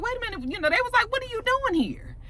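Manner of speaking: surprised. Retold or imitated. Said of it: "Jason was just like".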